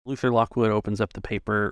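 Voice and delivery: usual speaking voice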